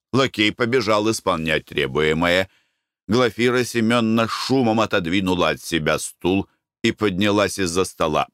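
ordinary delivery

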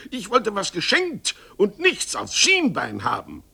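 high, loud yelp of pain; pained cry. Acted. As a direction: cry out, rubbing the sore shin. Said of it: "rieb sich die schmerzende Stelle und schrie".

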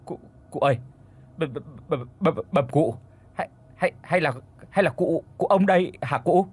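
broken stutter on first sounds; stammering. A narrator's reading. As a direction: get stuck nervously on each word before it comes out. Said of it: "mà nói lắp bắp".

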